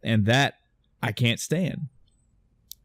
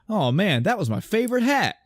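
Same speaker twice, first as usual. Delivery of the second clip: higher-pitched, mock whine; jokey dub hero; 8 semitones higher than usual. Mocking the English dub's hero losing his hat, jokey complaint. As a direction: play it as the joker, pitch climbing to the last word. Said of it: "that tone of that character".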